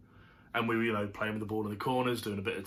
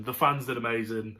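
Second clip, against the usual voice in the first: usual speaking voice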